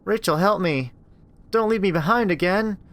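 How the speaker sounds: put-on high, thin child's plea; a little sister begging her big sister; pleading little girl. Little sister begging Rachel through the door, pleading.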